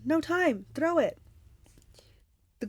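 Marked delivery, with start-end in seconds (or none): 0.0-1.2 high urgent yell. Acted. Percy yelling to Tyson, high urgent yell. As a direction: call across the field. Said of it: "but I yelled".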